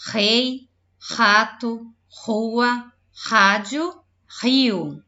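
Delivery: loud list with strong initial r; strong R demo. Shown as a demonstration. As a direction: give each word a firm opening r. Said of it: "olha o som".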